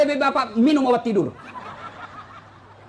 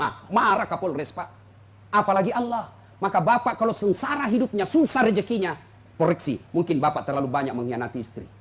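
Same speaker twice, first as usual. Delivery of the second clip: ordinary talk, nothing marked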